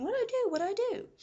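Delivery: high, loud mock-frantic cries; panicked screaming. Nurse facing the burning room, screaming in panic. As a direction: squeal it helplessly, acting out a panic. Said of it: "she's screaming".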